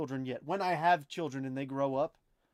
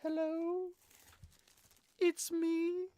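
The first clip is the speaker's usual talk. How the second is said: much higher, crooning [name] impression; much slower than usual. Reading a chat message aloud, high crooning [name] voice. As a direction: croon it like the famous ballad. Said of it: "I read it in [name]'s voice".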